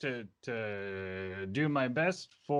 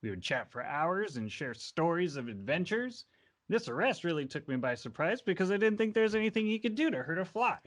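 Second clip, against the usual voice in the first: higher-pitched chatty witness, building to protest; lightly voiced innkeeper witness; 6 semitones higher than usual. Innkeeper witness telling the court about Erebus, lightly voiced and chatty. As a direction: vouch eagerly for an old friend.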